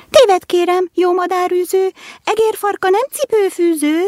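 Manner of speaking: high squeaky little mouse voice. A narrator's reading. Mouse squeaking back at the little shoe, high and squeaky.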